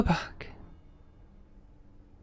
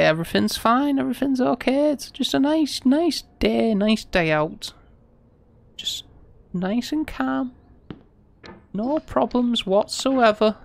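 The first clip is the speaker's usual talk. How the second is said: mock soothing; 6 semitones higher than usual. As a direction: talk yourself down like calming a child. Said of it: "I'm spazzing out a little bit. I need to, to take a breath".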